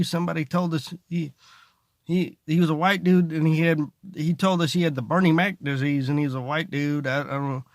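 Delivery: ordinary delivery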